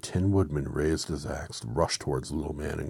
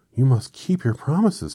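put-on girl's voice; 10 semitones higher, 12 dB louder than usual. Dorothy insists.

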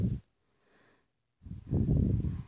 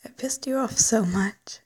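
ordinary talk

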